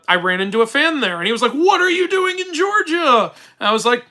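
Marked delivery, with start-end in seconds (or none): 1.5-3.3 surprised fan. Retold or imitated. Fan surprised to see him abroad.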